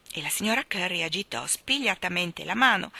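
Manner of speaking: usual speaking voice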